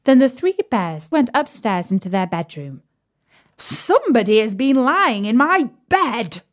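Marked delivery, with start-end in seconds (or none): 3.6-6.4 higher, rough mock bear voice; storybook papa bear, mock gruff; great gruff bear. A narrator's reading. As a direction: play the great huge bear, hammed-up and rough. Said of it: "in his great rough, gruff voice".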